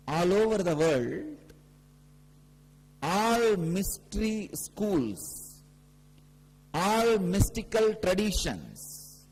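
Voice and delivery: high, drawn-out proclamation, phrase by phrase; slow, forceful spiritual proclamation; emphatic preaching. Real, not acted. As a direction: stretch each key phrase and declare it to the whole gathering. Preaches the main teaching.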